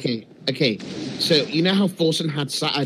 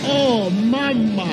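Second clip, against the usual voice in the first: slow, drawn-out Italian exclamation; hammed-up Italian accent, higher; mock Italian accent; 4 semitones higher, much slower than usual. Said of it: "Italian's like this".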